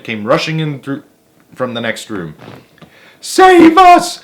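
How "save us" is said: very high, loud shriek for help; old woman crying out in terror; panicked high-pitched plea from an old woman; terrified cry. A narrator's reading. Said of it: "cried Grandma Josephine".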